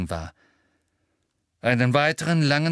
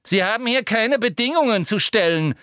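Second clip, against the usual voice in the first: brusque, higher Umbridge; curt; 11 semitones higher, 4 dB louder than usual. A narrator's reading. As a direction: cut her off. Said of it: "erwiderte Professor Umbridge schroff". (schroff erwidert)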